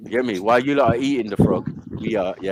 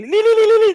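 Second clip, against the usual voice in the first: very high, loud li li li like a siren; siren imitation; 6 dB louder, faster than usual. Mimicking the siren heard in your sleep, high and loud. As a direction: sing out a wailing siren on li. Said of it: "that is called sirens".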